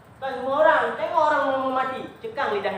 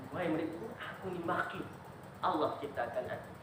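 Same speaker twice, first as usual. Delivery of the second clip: low, much softer address; soft humble teacher; 4 semitones lower, 11 dB quieter than usual. Acted. Teacher quietly explaining his fate to his student. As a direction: speak down gently to the pupil.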